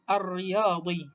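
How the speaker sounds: slow "ar-riyadi" with a held double R; lam dropped, ra doubled. Shown as a demonstration. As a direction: say "ar-riyadi" with no L, straight into the r. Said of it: "huruf ra terus".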